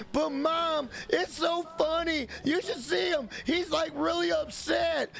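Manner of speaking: hammed-up kid begging to stay online; whiny son. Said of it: "son pleading".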